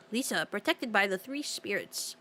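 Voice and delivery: ordinary delivery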